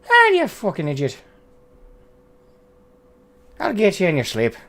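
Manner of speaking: high-pitched mock-Irish threat; Seamus. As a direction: put on a high, cartoonish Irish accent. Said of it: "Seamus's accent is all of them".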